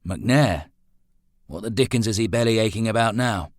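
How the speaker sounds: exasperated. A narrator's reading. Employer hears of his gardener's complaint, exasperated. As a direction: raise voice and pitch in irritation.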